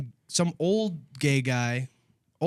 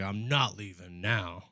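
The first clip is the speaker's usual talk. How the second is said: mock stubborn drunk; 8 semitones lower, 8 dB quieter, slower than usual. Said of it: "he was like".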